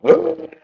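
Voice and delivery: short high-pitched strain noise; imitated straining gasp. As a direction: mimic a little kid straining. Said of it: "and he's going".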